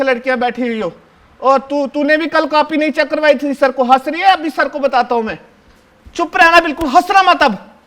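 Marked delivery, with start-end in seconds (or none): 6.1-7.8 raised voice, stern hush order; loud warning. Acted. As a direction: bark the warning at the giggling kids. Warning classmates to keep quiet.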